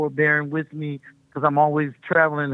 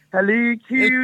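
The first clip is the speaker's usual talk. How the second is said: high, louder playful Canadian imitation; mock Canadian accent; 8 semitones higher, 4 dB louder, slower than usual. American guest teasing the host's way of talking, mock Canadian accent. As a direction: put on an exaggerated Canadian lilt. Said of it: "the thing you guys do".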